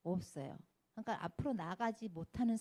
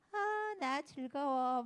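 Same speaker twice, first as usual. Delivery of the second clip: drawn-out, higher show of joy; hammed-up cheerfulness; 6 semitones higher, much slower than usual. Masking pain before others, hammed-up cheerful. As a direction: gush slowly as if having a great time. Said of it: "막 웃으면서".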